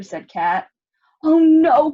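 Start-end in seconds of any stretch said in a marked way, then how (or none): 1.2-1.9 high, loud dismayed cry; cried out. Acted. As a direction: wail at the broken pieces. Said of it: "cried Spoon".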